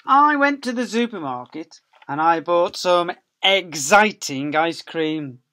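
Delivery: high, loud, slow storybook voice; reading to children. Acted. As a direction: read it out big and lively for little ones. Reading the picture book aloud.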